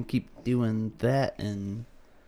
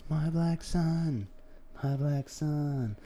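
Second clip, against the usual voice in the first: softer, slow doting dad voice; slow soft fatherly voice; 3 semitones higher, 7 dB quieter, slower than usual. Retold or imitated. Retelling the joke to a friend, slow soft proud-father voice. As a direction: croon it slowly like a proud father. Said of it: "I was like".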